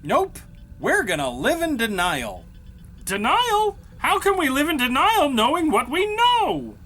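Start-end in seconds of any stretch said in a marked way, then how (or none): 3.0-6.8 incredulous. Acted. Incredulous reply to denial plan.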